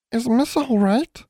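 tiny, very high, slow elf voice; anxious elf. A narrator's reading. Elf shaking an unconscious girl, asking anxiously. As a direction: squeak it out with timid concern. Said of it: "the elf asked anxiously".

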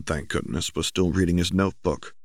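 usual speaking voice

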